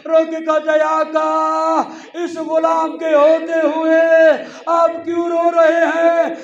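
impassioned majlis lament; preaching at full force. Acted. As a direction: shout the grief to the mourners.

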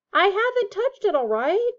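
high, loud angry denial; roaring back. A narrator's reading. Ron defends himself to Percy, roaring back. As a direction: fire back in protest. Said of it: "Ron roared back".

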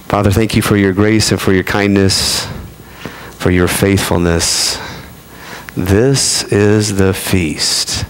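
slow, reverent prayer voice; lightly voiced pastor's closing prayer. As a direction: pray it unhurried and earnest.

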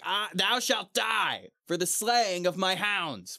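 high, slow mock threat; mock vengeful Gawain. Gawain condemning the fallen knight for his hounds, mock stern. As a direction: lift the voice and sentence him. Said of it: "he's like".

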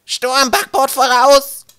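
very high, slow, loud lookout yell; piercing cry. A narrator's reading. Lookout warning the ship of a storm, piercing cry. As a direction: stretch it out as a shrill alarm. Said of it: "einen gellenden Schrei aus".

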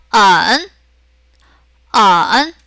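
언 dipping low, then rising; drawn-out dip and lift on 언; third tone. Shown as a demonstration. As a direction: say 언 in Mandarin third tone. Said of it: "그럼 삼성해서".

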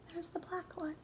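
hushed whisper; quiet secret whisper. Real, not acted. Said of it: "whispered pointing out".

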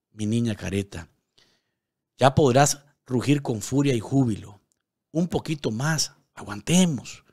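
heartfelt, emphatic poem recital with long falling phrases; poem reading. Acted. News host reads a viewer's poem to Nicaragua, heartfelt recital. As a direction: read it like a love letter to a homeland.

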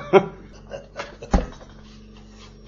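a long breathy chuckle; wheezy laugh at a fond memory; hearty laugh. Real, not acted. Old man recalling youthful fun.

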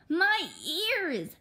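loud cry of ear pain. Acted. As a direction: wail it out in pain. Crying out in pain.

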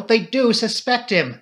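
slow, high, loud insistence; agitated, emphatic cry; cried out. A narrator's reading. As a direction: drive the point home urgently. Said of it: "cried the girl".

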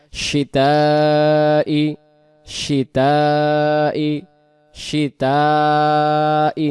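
শিতাই with শি held about four alifs; long shin. Shown as a demonstration. Teacher demonstrating the long stretch in শিতাই. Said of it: "টান দেব চার আলিফ".

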